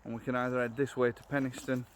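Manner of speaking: ordinary talk, nothing marked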